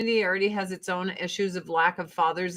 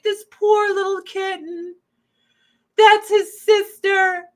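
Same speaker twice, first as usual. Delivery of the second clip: pitying coo; 10 semitones higher, 4 dB louder than usual. Retold or imitated. Cooing over the scared kitten at the shelter. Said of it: "I'm like".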